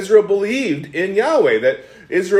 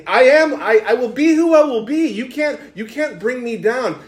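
lightly voiced God, higher-pitched and firm; defiant God; 7 semitones higher than usual. God to people who would bring him down, defiant. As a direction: declare it with raised pitch, refusing to be contained. Voicing God's defiant self-declaration.

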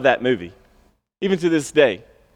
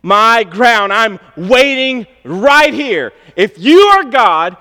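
higher, louder, slowed demand building then easing off; Habakkuk's demand; 6 semitones higher, 4 dB louder than usual. Acted. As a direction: challenge God with mounting intensity. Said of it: "Habakkuk has a similar thing".

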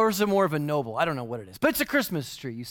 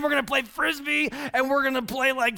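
higher, eager doggy voice; dog imagining playtime, eager and higher; excited dog thoughts; 7 semitones higher than usual. Pretend. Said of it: "in his little doggy mind, he's thinking".

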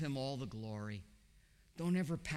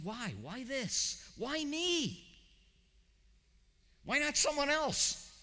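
plaintive why me; 9 semitones higher, slower than usual. Pretend. Imagined cry of sufferer in trial.

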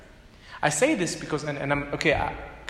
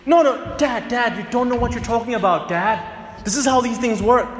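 high, fast talking-down to dad; rude dismissive teen; 6 semitones higher than usual. Acted. Son dismissing his father, high and fast. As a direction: brush dad off with attitude. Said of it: "rather say".

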